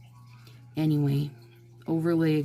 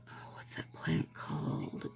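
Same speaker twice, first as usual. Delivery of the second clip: murmured whisper while trying to remember; whispered to self; 11 dB quieter than usual. Real, not acted. To herself, trying to recall the plant, whispering. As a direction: whisper it, searching your memory.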